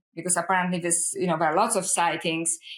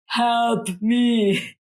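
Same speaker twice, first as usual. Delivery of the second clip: stiff robotic plea, a bit louder; 4 dB louder than usual. Retold or imitated. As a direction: say it like a pleading machine. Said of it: "says in this robotic voice".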